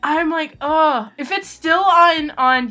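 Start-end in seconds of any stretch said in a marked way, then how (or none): none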